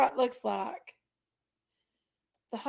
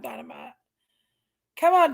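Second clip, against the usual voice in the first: ordinary talk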